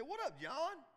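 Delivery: high, bright casual hello; greeting someone by name, high and casual. Acted. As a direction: toss a friendly what up. Acting out greeting back.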